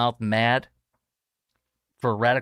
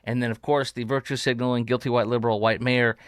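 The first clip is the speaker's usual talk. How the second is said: ordinary talk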